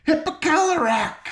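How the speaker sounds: very high furious shriek; furious scream. A narrator's reading. King screaming in fury at the third ring. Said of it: "he screamed in a fury".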